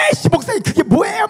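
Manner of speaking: high, shouted mock outburst; imitated angry, indignant yell; child losing temper. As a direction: blow up like a fed-up kid. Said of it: "걔가 막 성질 내면서".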